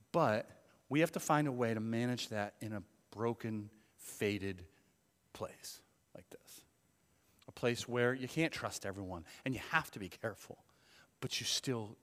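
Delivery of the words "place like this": 1 hushed whisper. Real, not acted. Whispered aside in sermon.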